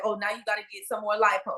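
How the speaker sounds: usual speaking voice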